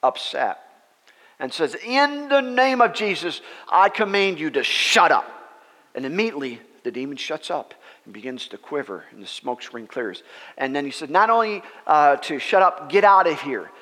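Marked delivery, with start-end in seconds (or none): 1.7-5.2 loud, high, forceful command; upset Brother Hagin. Retold or imitated. Hagin rebuking the noisy demon, upset shouted command. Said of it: "Brother Hagin got upset". 11.1-13.7 loud, raised command; upset Brother Hagin. Retold or imitated. Hagin driving the demon away, loud angry order. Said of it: "Brother Hagin got upset".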